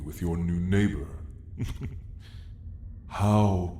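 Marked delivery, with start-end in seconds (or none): 1.1-3.0 brief breathy amused laugh; a hushed, indulgent little laugh; soft chuckle. Acted. Dragon amused by the neighbor's friendliness, soft chuckle.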